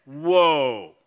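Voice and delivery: loud, startled-sounding mock "whoa"; stern rebuke. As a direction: call a halt, loudly. Retold stern reaction to fake fit.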